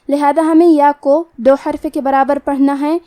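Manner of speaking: usual speaking voice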